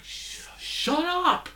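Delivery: very high, slow mock hush; mock Peter shushing. Vlogger voices Peter hushing his blabbing friend, slow and very high.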